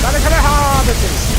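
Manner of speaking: high, loud battle yell. Real, not acted. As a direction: scream it mid fight. Yelling while casting.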